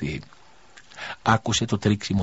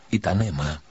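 ordinary delivery

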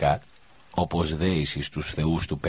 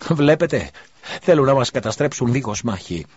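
much higher and louder near-scream; almost screaming; 7 semitones higher, 6 dB louder than usual. Acted. Priest leaps up warning the crowd, almost screaming. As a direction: cry out in alarm to rouse the crowd. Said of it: "ουρλιάζοντας σχεδόν".

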